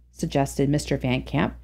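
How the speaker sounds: ordinary delivery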